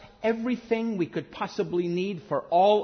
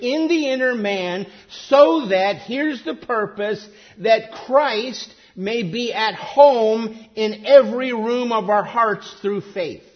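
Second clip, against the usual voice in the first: louder, driving sermon delivery; preaching at full force; 3 semitones higher, 5 dB louder than usual. Acted. Preacher urging the congregation, loud and driving. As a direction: press the point home from the pulpit.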